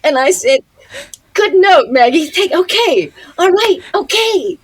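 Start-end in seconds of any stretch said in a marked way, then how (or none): 1.3-4.6 loud, high, over-eager acceptance; re-enacted flustered agreement. Actress taking a note from co-star, high re-enacted agreement. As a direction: agree hastily in a raised, high voice. Acting her chastened reply to co-star.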